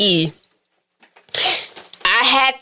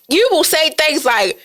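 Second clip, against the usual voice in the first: ordinary talk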